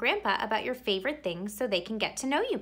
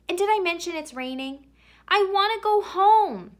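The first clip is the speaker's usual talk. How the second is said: high and louder, wailing to go home; whiny plea; 9 semitones higher, 6 dB louder than usual. Acted. Bored boy whining to his parents to come home. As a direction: whine it like a homesick kid.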